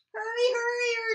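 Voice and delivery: high, loud urgent call; urging. Acted. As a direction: push them to hurry. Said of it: "urged Bear".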